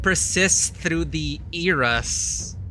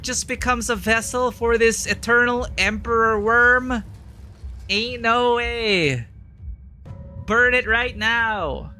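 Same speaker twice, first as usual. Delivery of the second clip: loud disbelief; 7 semitones higher, 3 dB louder than usual. Real, not acted. Reacting to the worm emperor.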